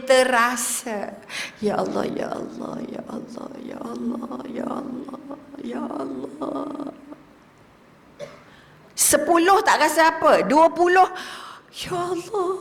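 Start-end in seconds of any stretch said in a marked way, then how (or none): 1.6-6.9 breathy, soft, drawn-out ya Allah; hushed devotional chanting; soft zikir. Shown as a demonstration. Demonstrating slow heartfelt zikir. 11.7-12.5 felt zikir. Shown as a demonstration. Zikir felt deeper at thirty.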